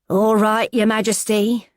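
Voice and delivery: put-on an amused East London cellmate teasing the posh girl. Smithy mocking her new cellmate in an East London accent. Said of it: "throaty East London voice filling the small room".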